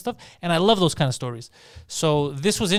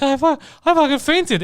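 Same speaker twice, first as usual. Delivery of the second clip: high, loud, excited fainter voice; mock viewer testimonial; 11 semitones higher, 5 dB louder than usual. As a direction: gush like a shaken moviegoer.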